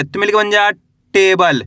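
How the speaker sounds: usual speaking voice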